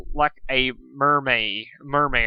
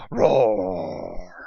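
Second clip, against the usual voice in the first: low growling roar; mock roar; 5 semitones lower, 3 dB louder than usual. Reader hamming up the Manticore's roar. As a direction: let out a hammy beast roar. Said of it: "Like my roar".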